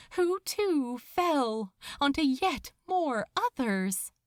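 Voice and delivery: ordinary delivery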